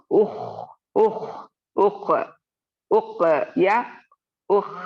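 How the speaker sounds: उख clipped and pushed out too hard; wrong reading. Shown as a demonstration. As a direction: show the faulty उख. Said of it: "उख ऐसे नहीं पढ़ेंगे".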